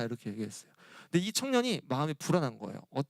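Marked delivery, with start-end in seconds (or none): none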